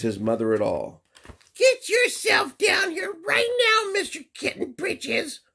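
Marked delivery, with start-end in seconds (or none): 1.5-5.4 shrill-free but sky-high nagging mom; bossy mama cat. Acted. Mother calling her kitten down for bed-sleeping. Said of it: "ordered Mama Junebug Jones".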